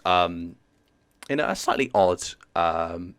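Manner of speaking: ordinary talk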